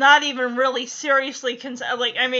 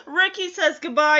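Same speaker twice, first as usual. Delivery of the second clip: ordinary delivery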